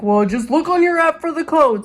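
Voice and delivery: mock the rude clerk's dismissive tone. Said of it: "if that guy wasn't rude".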